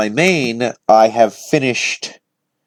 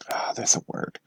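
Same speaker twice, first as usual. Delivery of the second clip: whispering; 12 dB quieter than usual. Real, not acted. Searching for a word, whispered.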